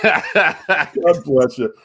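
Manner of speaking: drawn-out, laughing "God bless you"; slow amused blessing. Real, not acted. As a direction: bless him slowly through a laugh.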